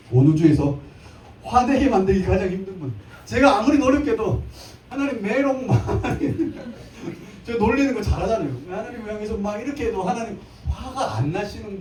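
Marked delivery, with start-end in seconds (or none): 4.9-7.5 playful nyah-nyah, dissolving into a laugh; real giggle at the joke; teasing laugh. Real, not acted. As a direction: tease and crack up. Teasing God with a raspberry.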